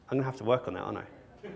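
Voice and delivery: normal talking voice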